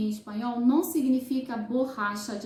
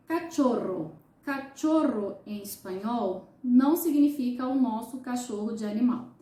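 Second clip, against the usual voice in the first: ordinary talk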